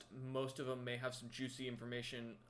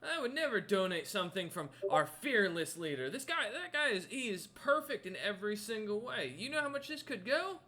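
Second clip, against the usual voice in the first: loud, high-pitched, swooping outrage; indignant devotee; 8 semitones higher, 7 dB louder than usual. Acted. Guide refusing to donate his leader's relic, high indignant protest.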